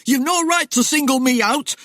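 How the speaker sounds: high, pleading protest; frightened Timmy. A narrator's reading. Accused man offers up others to Death, frantic.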